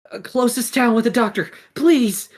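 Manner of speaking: high, loud, fast begging; urgent plea. Acted. Gecko urging the horse toward a doctor, urgent plea. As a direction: beg in a panicked rush.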